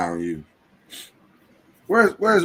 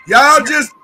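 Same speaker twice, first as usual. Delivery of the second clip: slow booming sit-down order; loud preacher command; 9 semitones higher, 9 dB louder, much slower than usual. Acted. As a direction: boom it slow from the pulpit. Mock preacher closing.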